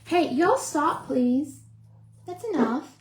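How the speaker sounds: high, loud, slow command to the dogs; scolding the dogs. Real, not acted. Owner snaps at her barking dogs, loud scold.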